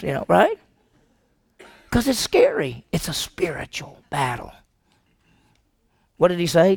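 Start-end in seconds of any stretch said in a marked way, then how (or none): 2.9-4.5 sudden hushed whisper. Real, not acted. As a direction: drop to a whisper, softer, for weight. Preacher drops voice mid-sermon.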